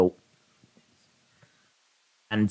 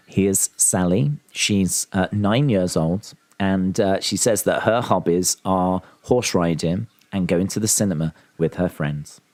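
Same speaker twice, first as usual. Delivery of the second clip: pageant host voice; 7 semitones lower than usual. Acted. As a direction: present the little girl like a beauty pageant host. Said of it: "I could do, uh, Little Miss Universe".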